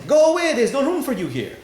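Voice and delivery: high, loud dismissal through the door; loud rejection. Retold or imitated. Beloved turns the lover away.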